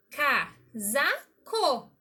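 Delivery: slow, casaco spelt out as ca-sa-co; split into syllables. Shown as a demonstration. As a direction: stretch casaco into its three syllables. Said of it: "Três sílabas separadas". ("casaco" bem devagar, ca-sa-co)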